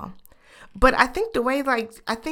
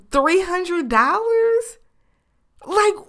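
incredulous; 11 semitones higher, 5 dB louder, much slower than usual. Real, not acted. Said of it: "incredulous price reaction".

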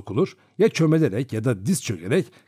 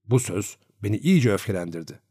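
plain reading voice

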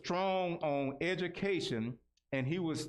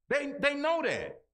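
higher and louder, hammered home; emphatic shout; 10 semitones higher, 4 dB louder than usual. Acted. Repeats line to hammer point.

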